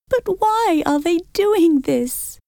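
high, slow frightened question. A narrator's reading. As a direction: ask it scared, voice rising. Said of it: "in a frightened voice".

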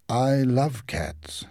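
understated, earnest confession; sorrowful consul. A narrator's reading. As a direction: draw it out with tender regret. Consul sorrowfully explains shooting cats.

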